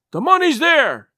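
high, loud call back; shouted reply. A narrator's reading. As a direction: shout the answer down from upstairs. Man calls back to roaring Pugh.

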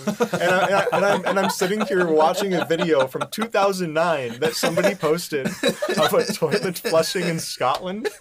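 high, loud, helpless laughing delivery; laughing while talking. Real, not acted. Podcaster confessing absurd late-night research to co-hosts, cracking up. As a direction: tell it through laughter, pitch way up.

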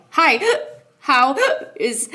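hiccupping between words; hiccups. Retold or imitated. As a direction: gasp a hiccup mid-greeting. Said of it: "I have the hiccups".

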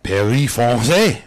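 hammed-up French, drawn out slowly; mock French accent. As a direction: overdo the French, nice and slow. Hammed-up French reading of place.

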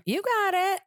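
high, bright-pitched "You got it"; mock instructor cheer, way up high; instructor's cheer. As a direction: squeal it up high like a cheering coach. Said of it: "he kept doing, like".

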